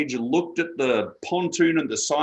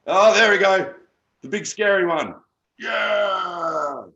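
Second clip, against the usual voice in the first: excited; 7 semitones higher, 5 dB louder, slower than usual. Real, not acted. Shows off shark toys.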